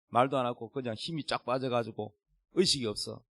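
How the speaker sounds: usual speaking voice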